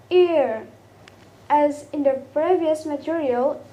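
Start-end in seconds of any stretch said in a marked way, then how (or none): 0.1-0.7 "Ear" louder, drawn out and falling; diphthong demo. Shown as a demonstration. Teacher restarting with endings in ear, drawn-out demo. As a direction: model "Ear" as one long glide. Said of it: "now we start with ending with ear".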